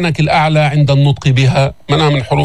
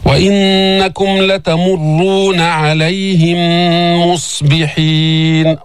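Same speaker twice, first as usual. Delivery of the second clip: slow chanted verse; ترتيل بطيء; Quran recitation; slower than usual. Shown as a demonstration. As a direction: recite slowly, merging the mims. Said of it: "الصاد ليس فيها شدة".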